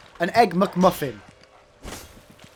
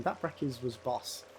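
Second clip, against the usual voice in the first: imitating Korean dude; 4 semitones lower, 12 dB quieter, slower than usual. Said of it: "this Korean dude going".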